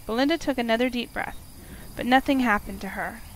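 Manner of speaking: ordinary delivery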